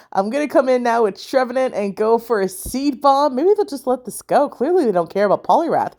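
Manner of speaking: ordinary talk, nothing marked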